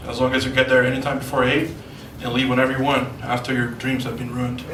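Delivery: normal talking voice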